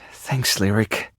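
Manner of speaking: low, slow and deflated; subdued thanks. Acted. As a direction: pull back from panic, downcast. Subdued thanks after near panic.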